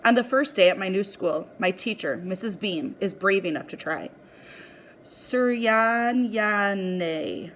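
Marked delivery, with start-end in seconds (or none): 5.0-7.5 Suryanyane sounded out very slowly, syllable by syllable; halting attempt at a name. A narrator's reading. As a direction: stumble slowly through Suryanyane like a teacher trying. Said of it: "she says, her eyebrows twitching as she attempts to sound it out".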